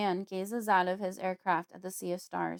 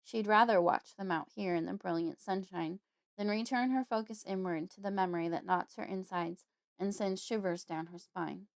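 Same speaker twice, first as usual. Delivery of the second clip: normal talking voice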